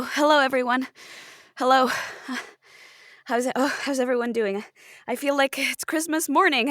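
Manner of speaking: ordinary talk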